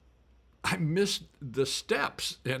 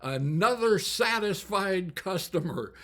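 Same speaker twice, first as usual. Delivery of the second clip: raised, higher call into the mic; mock-announce it to the crowd; retold yell; 6 semitones higher, 5 dB louder than usual. Walt yelling into the microphone at the fallen singer, raised and higher. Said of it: "steps up to the microphone and yells".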